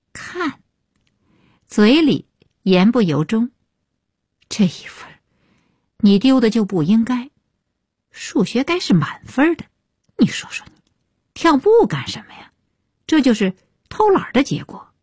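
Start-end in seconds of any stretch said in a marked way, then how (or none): 4.5-14.9 higher-pitched chiding meant to be overheard; showy mild scolding. A narrator's reading. Mother chides son over one lost point, showing off scolding. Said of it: "声音也不由自主地放大".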